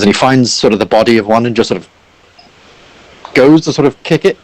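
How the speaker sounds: ordinary delivery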